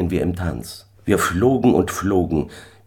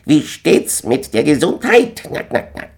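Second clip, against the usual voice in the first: high, loud question trailing into cackles; squawk, then cackle like a goose; chattering gander; 10 semitones higher, 4 dB louder than usual. A narrator's reading. Gänserich fragt und schnattert.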